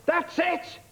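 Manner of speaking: high, slow mock outrage over the mic; outraged club owner. Owner on the mic scolding the club, high mock outrage. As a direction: bellow like a tiny boxer owner at the crowd.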